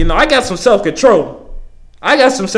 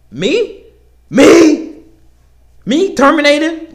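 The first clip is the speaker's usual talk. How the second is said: higher and louder, mock shocked me; outraged disbelief; 7 semitones higher, 3 dB louder, much slower than usual. Worker retelling being threatened with termination, shocked indignant me. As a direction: play the wronged innocent, building.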